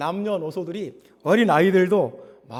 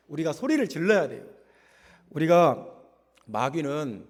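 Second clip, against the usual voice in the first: normal talking voice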